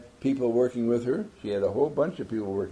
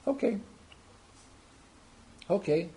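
car voice; 8 semitones higher, 4 dB quieter than usual. Acted. Car answers the driver.

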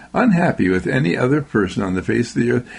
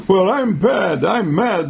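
higher, mock-indignant retort; mad neighbor; 6 semitones higher, slower than usual. Neighbor answering the meter reader, mock anger. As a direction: insist on being mad, lightly acted. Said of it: "the neighbor said".

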